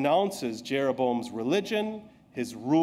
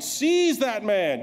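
slow, higher, louder royal command; shouted command; 7 semitones higher, 4 dB louder, much slower than usual. Acted. Preacher acting the king ordering the prophet's arrest, a slow loud command. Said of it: "he pointed at him and shouted".

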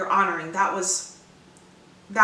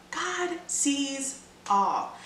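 slow emphatic; 5 semitones higher, much slower than usual. Real, not acted. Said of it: "slow emphasis".

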